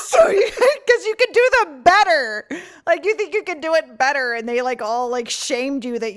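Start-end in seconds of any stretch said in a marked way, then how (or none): 0.0-2.5 talking through real laughter, high and loud; laughing. Real, not acted. Hosts riffing on killing coworkers for their jobs, laughing. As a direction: crack up while getting the words out.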